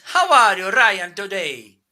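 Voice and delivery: Italian accent. Shown as a demonstration. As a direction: ham up an Italian accent for Ryan. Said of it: "I can speak with Italian accent".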